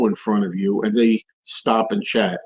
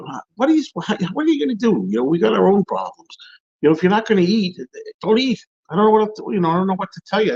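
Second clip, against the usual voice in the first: exasperated rant; 6 semitones higher, faster than usual. Real, not acted. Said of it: "here's another rant".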